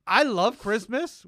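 high, loud, slow gushing; excited. Real, not acted. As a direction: gush about loving it. Gushing about Christmas.

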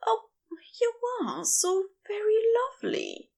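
a lovestruck sigh, barely voiced; sighed whisper. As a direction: let it slip out on a breath, unguarded. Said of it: "sighs out".

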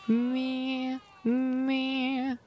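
high bleating sheep voice. Acted.

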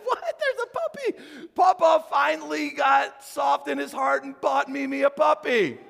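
squealing very high, laughing with delight; excited kids. Retold or imitated. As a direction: gasp and gush like a thrilled child. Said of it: "They would get all excited".

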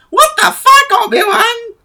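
very high, loud squawking Jar Jar; high-pitched mock Jar Jar protest. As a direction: shriek it like an outraged Gungan. Jar Jar answers Obi-Wan, acted.

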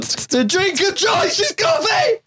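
high, slow hyped announcement; excited. Acted. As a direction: drag it out, hyped. Mock announcement.

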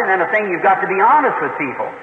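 ordinary talk

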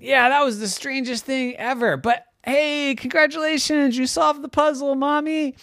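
put-on high, cheerful child voice; excited kids. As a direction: congratulate Mom like a thrilled kid. Kids congratulate their mother.

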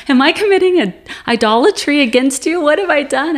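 loud, high, fast anguished questioning; retold crying plea. Woman asks God if she has sinned, acted distraught crying. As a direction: act out frantic, tearful pleading. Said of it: "And I'm crying".

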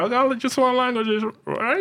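high-pitched disbelieving question; high incredulous question. Retold or imitated. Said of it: "I'm like".

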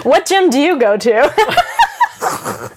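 slow giggly tease; laughing. Real, not acted. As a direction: tease her while laughing. Teasing question while laughing.

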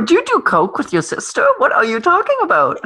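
high-pitched disbelieving questioning; hammed-up, high-voiced disbelief; mock incredulous. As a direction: challenge her as if baffled. Mock questioning of singer's quote.

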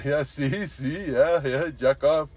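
eager chiming-in, imitating a Mexican accent; imitated Mexican coworker. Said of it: "the other guy, George, is going".

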